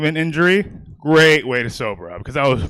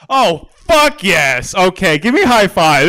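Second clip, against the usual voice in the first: slow, loud, higher cheer; thrilled; 4 semitones higher, 5 dB louder than usual. Real, not acted. Comic thrilled to find an audience member who's been to jail. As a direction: cheer it out with delight.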